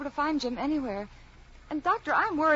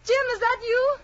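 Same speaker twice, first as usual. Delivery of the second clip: loud, high, hopeful call; 12 semitones higher, 7 dB louder than usual. Acted. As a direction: call to your husband at the door. Calls out to arriving husband.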